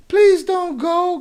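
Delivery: high, slow fake begging voice; mock pleading. Streamer mocking a banned troll's pleading, high and whiny. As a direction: whine it like a pitiful fan begging.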